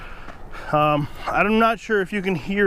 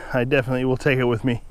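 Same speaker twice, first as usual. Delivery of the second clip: ordinary delivery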